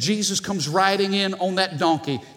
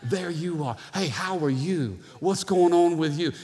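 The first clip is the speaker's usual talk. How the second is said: humble voice. Acted. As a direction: turn attention gently to the other. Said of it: "Humility walks into the room and says".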